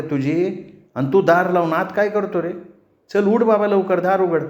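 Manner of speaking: high, insistent calling to her brother; calling through the door. A narrator's reading. Sister calls her brother to open the door, high calling. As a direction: call out urgently through a closed door. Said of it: "Chandani त्याला झडपेतून आवाज दिला".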